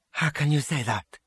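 understated indignant question; unrestrained anger. A narrator's reading. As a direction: protest with a touch of anger. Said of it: "unrestrained anger".

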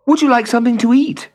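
put-on high, kind boy's offer; young boy voice. A narrator's reading. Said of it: "Hans said".

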